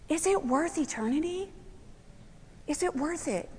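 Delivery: very soft, searching question; quiet, gentle plea; hushed challenge. Real, not acted. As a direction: let the question drop almost to nothing. Same question put again, hushed.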